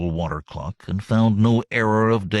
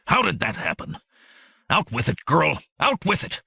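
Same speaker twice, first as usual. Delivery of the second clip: rising, impatient demand, pitch climbing higher; agitated demand; 8 semitones higher than usual. A narrator's reading. Shaken sorcerer demanding the story from the girl, agitated. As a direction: pressure her to speak, losing composure.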